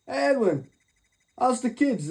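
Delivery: high sing-song taunt; mocking. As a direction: needle him with fake cheer. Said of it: "I said".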